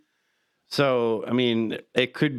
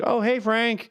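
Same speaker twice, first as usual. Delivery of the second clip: higher-pitched acted hello; mock friendly greeting; 10 semitones higher than usual. Coworker greeting the man with a new haircut, mock friendly. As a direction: play a coworker lightly greeting someone.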